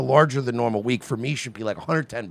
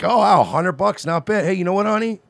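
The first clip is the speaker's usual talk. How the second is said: pleased husband; 7 semitones higher, 5 dB louder than usual. Retold or imitated. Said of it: "But he's like".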